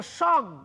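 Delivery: সং closed firmly on the nasal, then stopped; syllable cut off. Shown as a demonstration. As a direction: halt right after সং. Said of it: "থেমে যেতে হচ্ছে".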